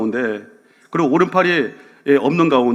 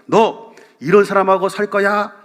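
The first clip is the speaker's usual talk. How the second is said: angry father's ultimatum; 7 semitones higher than usual. Retold or imitated. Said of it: "아빠가 이렇게 말했다고 합니다".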